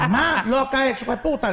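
high-pitched mock indignation; indignant refusal. Husband rejecting his wife's curious request, indignant.

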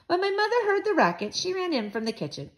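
ordinary delivery, nothing marked

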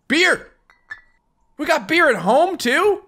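high, loud delighted cry; excited yell. Pretend. Gamer's character finds beer at home, high and loud. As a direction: cheer like beer is the best news ever.

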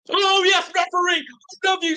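real fan celebration; ecstatic shout. Real, not acted. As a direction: scream your love at the ref. Penalty waved away, fan celebrates.

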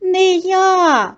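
high mouse voice; mouse calling out. A narrator's reading. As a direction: call back a high refusal. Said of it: "Гукає Верть".

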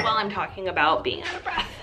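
ordinary talk, nothing marked

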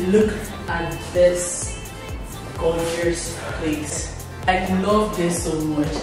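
usual speaking voice

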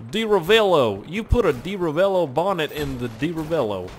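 imitated Southern game-character accent; Texas accent. Player imitating the game's Southerner, Texas accent. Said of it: "guy from Texas says that".

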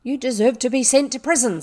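acted furious reprimand; angry scolding. A narrator's reading. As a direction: berate her with outrage. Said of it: "said Miss Minchin's voice".

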